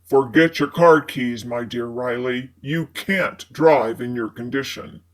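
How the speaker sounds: low male character voice; man's voice. A narrator's reading. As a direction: voice Fitz low and smooth. Fitz speaks.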